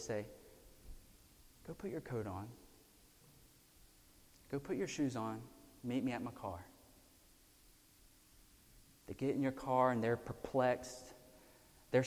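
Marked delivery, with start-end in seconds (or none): 1.7-6.7 soft, quiet, gentle instruction. Pretend. A parent softly telling the child to get ready, quiet. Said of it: "in the softness of your voice".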